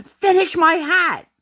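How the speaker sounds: high, drawn-out mock yell of a bossy toddler; yelling child. Toddler daughter ordering mom to finish her hat, yelling. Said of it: "is yelling at me".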